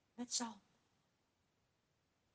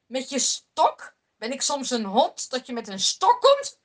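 loud, mocking giant's voice; booming giant; 9 dB louder than usual. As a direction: bellow down at the little boy. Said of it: "hij buldert met zijn zware stem". (reus Goliath bespot David: luid bulderend en spottend)

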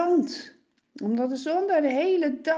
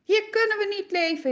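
higher, louder rising anger; angrier; 10 semitones higher, 5 dB louder than usual. Acted. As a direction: build the fury further. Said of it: "steeds kwader, steeds bozer".

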